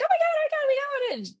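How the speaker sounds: very high, rushed mock squeal; excited squeal. Friends hearing a ghost on tape, excited squeal. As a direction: squeal it like thrilled ghost hunters. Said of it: "we got all excited".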